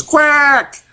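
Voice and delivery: high quacking duck noise. Retold or imitated. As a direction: play dumb and quack like a duck. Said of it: "he goes".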